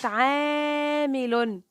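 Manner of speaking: "عامل" with the alif held very long; slow, sustained long a in "عامل"; long vowel. Shown as a demonstration. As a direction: stretch the first syllable of "عامل". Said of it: "نطقت العين مع الألف".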